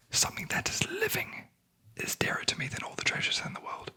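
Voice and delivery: ordinary delivery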